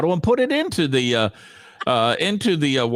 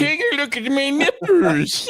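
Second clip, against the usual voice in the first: mocking imitation; 9 semitones higher, slower than usual. Mocking the co-host's nipple line.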